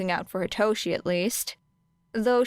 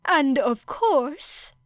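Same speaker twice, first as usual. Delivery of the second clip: high, slow, bright principal voice; drawn-out cheery high voice; 6 semitones higher, much slower than usual. A narrator's reading. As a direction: stretch it out brightly, pitched up. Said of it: "he said brightly".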